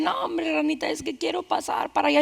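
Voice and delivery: a lightly acted weepy whimper; scorpion crying. A narrator's reading. Scorpion begging the frog for a crossing, mock tearful. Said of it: "se encuentra un alacrán llorando".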